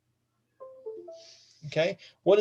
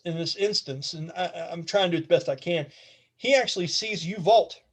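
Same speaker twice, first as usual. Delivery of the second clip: normal talking voice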